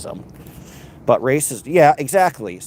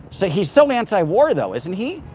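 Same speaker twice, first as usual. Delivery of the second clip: pitched high and a bit louder, dripping sarcasm; mock him with a raised, scornful question; sarcastic; 9 semitones higher, 3 dB louder than usual. Real, not acted. Mocking Trump as anti-war.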